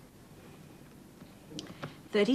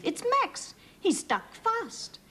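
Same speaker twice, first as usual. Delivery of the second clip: high, alarmed cry; alarmed farmer; 13 semitones higher, slower than usual. A narrator's reading. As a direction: realise it's Max, worried. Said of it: "cried Farmer Klaus".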